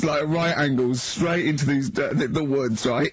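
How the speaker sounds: normal talking voice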